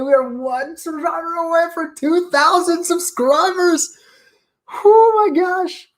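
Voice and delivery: holding back happy tears; close to tears. Real, not acted. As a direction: voice breaking with real joy. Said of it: "Don't cry".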